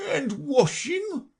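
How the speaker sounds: very slow, high, wavering question; Mock Turtle. A narrator's reading. Mock Turtle checks on washing, slow high wavering. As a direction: ask it dolefully, drawn out. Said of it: "said the Mock Turtle".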